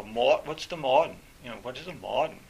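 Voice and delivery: "modern" as "mawd'n" in an imitated New England accent; mock New England accent on "the mawd'n". Puzzling over the professor's word, imitated New England accent. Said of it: "it was like that accent".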